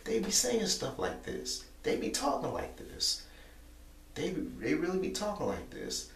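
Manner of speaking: high sarcastic parody of the guys; mocking high voice. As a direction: pitch it way up to mock them. Mocking how the guys talk.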